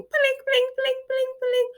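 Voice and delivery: very high, thin bell pings; ping like a small bell ringing in your head; imitated bell pling.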